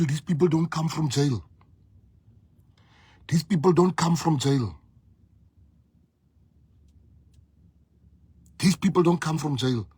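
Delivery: firm, rising insistence; insistent repetition. Real, not acted. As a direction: hammer the point home. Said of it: "repeated three times for emphasis".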